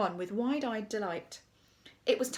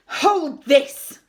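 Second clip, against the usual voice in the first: loud, higher command; barked order; 5 semitones higher, 13 dB louder than usual. A narrator's reading. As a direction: order it at full volume. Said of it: "he ordered".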